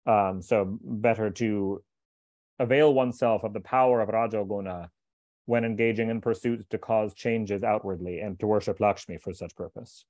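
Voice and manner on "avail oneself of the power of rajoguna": usual speaking voice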